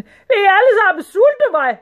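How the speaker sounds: high, loud outraged scream; screamed complaint. A narrator's reading. Sultan accusing servants of starving him, screaming. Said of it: "skreg sultanen".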